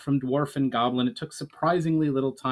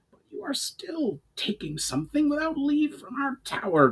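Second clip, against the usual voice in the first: high growling goblin voice; an angry goblin chief warning; Brawl, growling goblin. A narrator's reading. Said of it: "growled Brawl".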